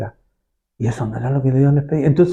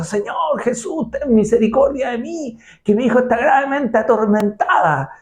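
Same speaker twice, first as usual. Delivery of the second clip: higher pleading plea; pleading cry; 7 semitones higher than usual. Retold or imitated. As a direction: beg for mercy, voice raised. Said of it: "daba voz y gritó". (súplica aguda de la mujer cananea)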